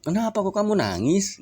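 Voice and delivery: lightly voiced father asking his crying daughter; gently asking father. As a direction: voice the father's worried question. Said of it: "kata Kanjeng Rasul".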